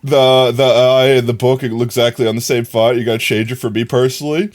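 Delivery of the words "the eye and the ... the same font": ordinary delivery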